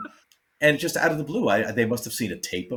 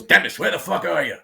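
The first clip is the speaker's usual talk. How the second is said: loud mock shout, pitched up; retold yell; 7 semitones higher, 6 dB louder than usual. George Carlin yelling for Dennis from backstage, mock loud shout. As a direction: yell across the backstage hall, imitating George.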